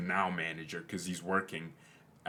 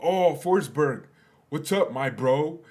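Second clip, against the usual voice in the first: higher and louder, slow, drawn-out American bro greeting; mock American accent; 6 semitones higher, 6 dB louder than usual. Coach greeting Forsberg, slow mock American accent. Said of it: "You know, American accent".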